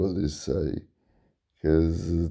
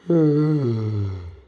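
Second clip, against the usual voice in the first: tired sigh; 5 semitones higher, 4 dB louder than usual. Real, not acted. Tired vlogger sighs after dozing.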